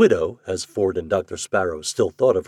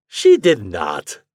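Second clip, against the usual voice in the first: slow, loud denial; protesting; 8 dB louder, much slower than usual. A narrator's reading. Ford hoping to be contradicted by the doctor, protesting. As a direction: draw out a hopeful protest. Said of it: "he protested".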